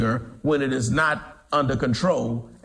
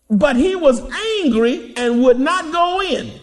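forceful preaching; 10 semitones higher, 5 dB louder than usual. Acted. Preacher reads angry brother.

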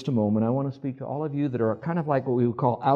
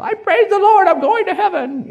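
very high, loud mock holy whoop; hammed-up heavenly rapture; super-spiritual believer; 10 dB louder than usual. Preacher mocks the so-spiritual in church, hammed-up high shout. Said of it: "some of you are so spiritual".

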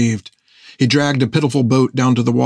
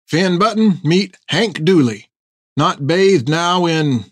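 put-on old sailor voice; 6 semitones higher, slower than usual. Topper introduces Hank.

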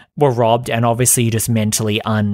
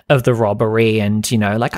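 normal talking voice

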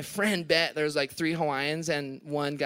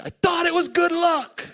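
high, pleading mock voice of Andy; mock whiny Andy; 12 semitones higher, 5 dB louder than usual. Imitating Andy's high whine about the broken board. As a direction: whine it up high like a wounded friend.

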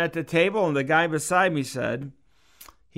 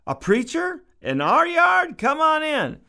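the mom squeals happily at a preacher in her yard; surprised mom; 13 semitones higher than usual. Retold or imitated. Mom welcoming the preacher, much higher and delighted.

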